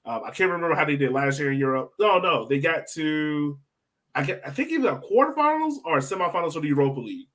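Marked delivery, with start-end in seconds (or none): none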